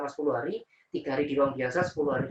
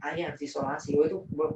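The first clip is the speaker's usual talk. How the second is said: ordinary talk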